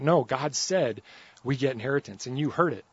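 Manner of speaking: normal talking voice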